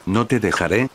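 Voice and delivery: usual speaking voice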